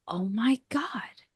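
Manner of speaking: slow, whispered inner thought; hushed realization. Retold or imitated. Podcaster recalling a sudden private thought, hushed realization. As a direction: whisper it slowly, as if to yourself. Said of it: "I'm thinking to myself".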